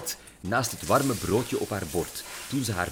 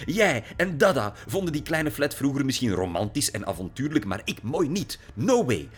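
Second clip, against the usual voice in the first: furious rant; 7 semitones higher, 4 dB louder than usual. A narrator's reading. Lily rants at her father.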